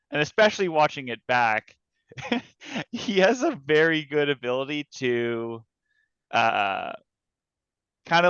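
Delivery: ordinary delivery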